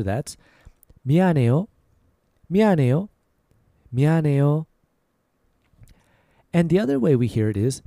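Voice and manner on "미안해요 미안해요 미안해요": natural everyday pace; a little faster. Shown as a demonstration. Teacher repeating at natural speed. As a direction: say 미안해요 at normal speed. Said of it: "A little faster".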